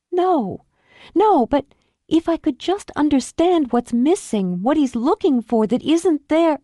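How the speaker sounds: put-on high, pained young wife; a wife making excuses for him; anguished excuses; Julie, distraught. Julie protests.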